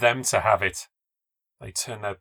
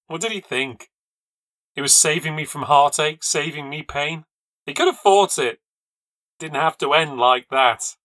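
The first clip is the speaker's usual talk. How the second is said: anguished anger; 4 semitones higher, 6 dB louder than usual. A narrator's reading. Grief and anger at husband.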